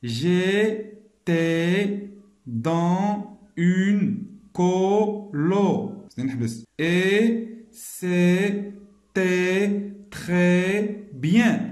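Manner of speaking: very slow, every syllable spaced out; slow syllabic reading for pupils. Shown as a demonstration. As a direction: read it out syllable by syllable. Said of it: "Je lis bien les syllabes".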